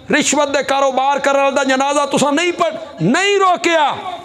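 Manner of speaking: rising pulpit crescendo; building impassioned preaching. Real, not acted. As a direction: pitch climbing with each item to a high cry. Preaches a climbing list.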